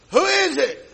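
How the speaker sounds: high, loud call from inside the house; startled shout of a man disturbed at home; calling through door. Retold or imitated. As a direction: yell it from behind a closed door.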